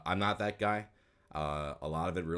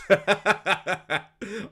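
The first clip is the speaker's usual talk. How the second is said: real high laugh, loud; loud burst of laughter; 14 semitones higher, 9 dB louder than usual. Real, not acted. Laughing at own casting.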